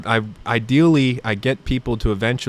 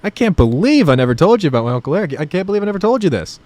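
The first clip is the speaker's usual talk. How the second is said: mock disbelief; 6 semitones higher, 4 dB louder, faster than usual. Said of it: "And I'm just like".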